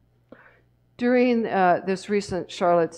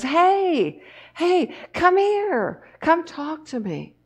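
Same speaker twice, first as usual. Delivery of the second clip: put-on high, slow calling voice of a gentle old man; 10 semitones higher, slower than usual. Old man calls the drunk over, high and gentle. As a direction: beckon him over, high and drawn out.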